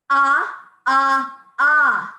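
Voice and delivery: three loud punched ahs from the belly; loud staccato ah. Shown as a demonstration. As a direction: pump each ah out loud and equal. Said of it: "So the first one sounds like this".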